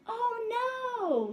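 high loud bee cry of alarm. Acted. Bees protest the mouse ducking under mud, high alarm.

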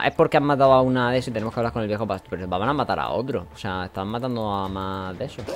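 normal talking voice

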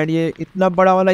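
normal talking voice

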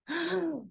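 a sudden shocked gasp; short surprised intake of breath; sharp gasp. Real, not acted. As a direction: gasp at what you just saw. Teacher sees spammed chat.

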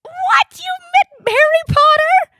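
high, loud astonished squeal; starstruck shock. Acted. Neighbor hearing about a famous meeting, starstruck and shrieky. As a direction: gush in disbelief at full pitch. Gushing over meeting Harry Potter.

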